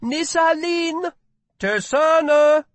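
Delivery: high, slow, loud hawking; urging market cry. A narrator's reading. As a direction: urge passersby to buy. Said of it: "urged another".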